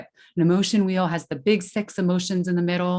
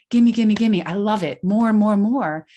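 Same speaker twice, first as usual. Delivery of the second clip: louder, higher imitation of a hungry kid; eager kid; 4 semitones higher, 6 dB louder than usual. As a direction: play the child who always wants more. Energetic child craving activities.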